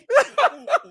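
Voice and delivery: high, loud burst of laughter; shrieking laugh. Real, not acted. Host cracking up at her own joke, loud shriek. Laughs after saying pissy.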